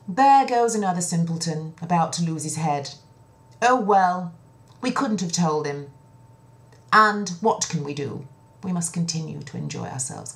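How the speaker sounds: a loud, dismissive sigh over the simpleton; eldest princess, scornful. Acted. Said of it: "And she looked at him and thought".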